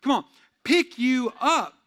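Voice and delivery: slow, loud rallying plea; urging. Real, not acted. Preacher rallying the church, loud and slow. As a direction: lift the room as you say it.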